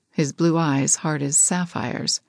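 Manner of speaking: plain reading voice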